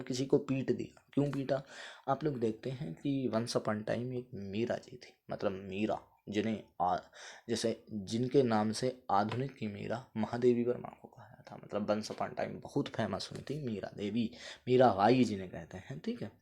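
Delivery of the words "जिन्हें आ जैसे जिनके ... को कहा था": normal talking voice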